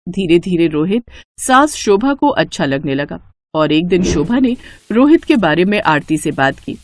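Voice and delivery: ordinary delivery, nothing marked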